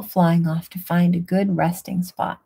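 ordinary delivery, nothing marked

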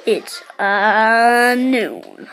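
low, drawn-out game gunslinger voice; amateur low-voiced hero imitation; McCree impression. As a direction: drop low for a mock cowboy catchphrase. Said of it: "That was my McCree impression".

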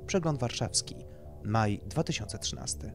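plain reading voice

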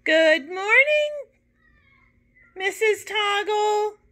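put-on high, loud sing-song chorus of children; bright children's chorus, pitched well up and loud; best morning voices. A narrator's reading. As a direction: chant it like a class greeting the teacher, high and slow. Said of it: "in their best morning voices".

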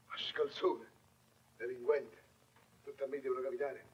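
ordinary delivery

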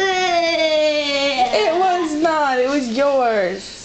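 very slow, stretched playful no; drawn-out teasing denial. Pretend. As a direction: stretch each word out teasingly. Teasing toddler who blames uncle.

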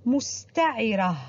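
slow clear mustaʕirah; pausal ta marbuta. Shown as a demonstration. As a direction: stop on مستعرة with the ta marbuta as h. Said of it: "عند الوقوف عليها لفظت هاء".

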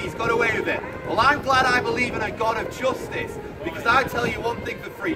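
ordinary delivery

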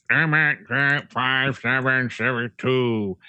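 slow, halting robotic readout; slow machine voice; computer voice. Retold or imitated. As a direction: recite numbers like an automated phone system. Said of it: "a computer voice giving you some numbers".

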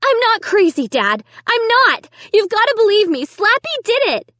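high, loud desperate pleading; frantic protest. A narrator's reading. Girl begging Dad to believe her, frantic.

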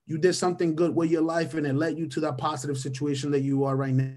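ordinary delivery

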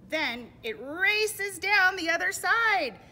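high, loud, stretched-out rush; big dramatic storybook swoop; excited narration. A narrator's reading. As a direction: build to a thrilling downhill cry. Cart races downhill in story.

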